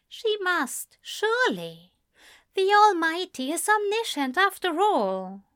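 high, earnest pleading; pleading angel. A narrator's reading. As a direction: plead with him. Aziraphale reasons.

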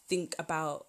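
ordinary talk, nothing marked

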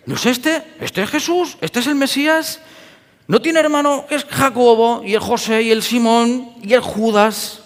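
high, incredulous mocking voice; scoffing townsfolk. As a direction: sneer it like a doubting villager. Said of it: "Imaginaoslos diciendo". (vecino burlón e incrédulo, voz aguda)

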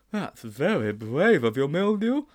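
slow schoolboy admiration; admiring Sponge. Acted. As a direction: draw out the admiration, lifting high at the end.

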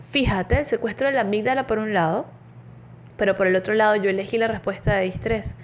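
ordinary delivery

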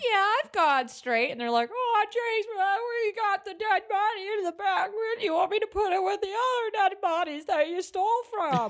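high-pitched, whiny cartoon character; Simpsons-style bit. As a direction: play it like a cartoon comedy scene. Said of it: "a Simpsons moment".

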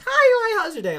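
very high, loud, exuberant greeting; imitating cheerful mom. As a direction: gush a mock motherly hello at the top of your range. Mom's cheerful greeting, retold.

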